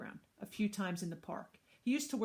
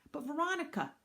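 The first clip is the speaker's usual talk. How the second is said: put-on high, protesting small-child voice; little boy; 9 semitones higher than usual. Little brother protesting to babysitter, high child voice.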